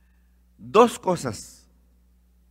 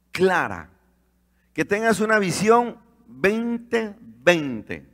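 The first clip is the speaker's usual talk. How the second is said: raised, slow, emphatic preacher voice; fervent preaching; 4 semitones higher, slower than usual. Acted. Preacher urging the congregation to see, fervent. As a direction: preach it out to the church.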